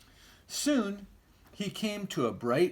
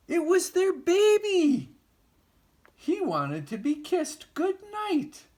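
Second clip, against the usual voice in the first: very high, loud excited reveal; 5 dB louder than usual. A narrator's reading. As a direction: burst out with the surprise. Reveal of baby.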